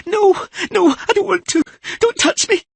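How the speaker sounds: loud, high frightened cry; terrified child. Acted. Siri crying out in terror at being touched. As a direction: push someone away in terror.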